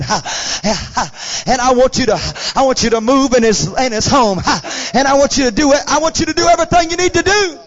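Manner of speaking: puffed "ha" between prayer phrases; imitated preacher voice. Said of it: "he's got the preacher voice on. He's going".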